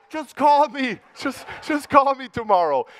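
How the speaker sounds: keen networking crowd, pushy and high; eager strangers begging. Retold or imitated. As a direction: beg him to call you tomorrow. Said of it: "talk to me and go".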